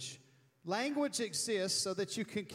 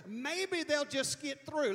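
ordinary talk

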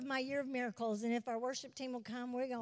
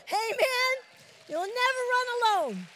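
much higher and louder, ringing; exultant amen; 10 semitones higher, 10 dB louder than usual. Real, not acted. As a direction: proclaim it like a triumph. Preacher repeating the promise exultantly.

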